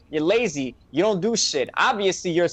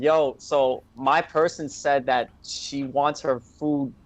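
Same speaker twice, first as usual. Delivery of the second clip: slow explaining; slower than usual. Retold or imitated. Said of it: "I also told him".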